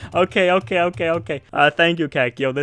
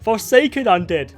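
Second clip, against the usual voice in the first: loud, raised call; loud, raised shout; 6 semitones higher, 4 dB louder than usual. Real, not acted. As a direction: shout it across the room. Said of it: "why are we shouting?".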